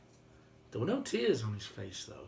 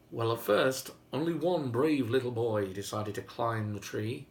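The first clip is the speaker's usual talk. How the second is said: ordinary delivery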